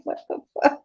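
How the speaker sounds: breathy hushed mock curse, almost whispered; son's hushed curse. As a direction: imitate the son muttering it under his breath. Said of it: "He was like".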